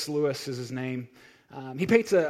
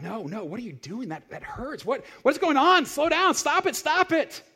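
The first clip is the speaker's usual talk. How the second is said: rising to high, loud panicked yelling; man in pain begging the angel to stop, panicked; panicked scream; 10 semitones higher, 7 dB louder than usual. Acted. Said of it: "the man screams out".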